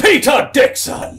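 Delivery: high, loud, slow proclamation of his own name; bellowed self-introduction; shouted name. Acted. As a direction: announce yourself like a showman.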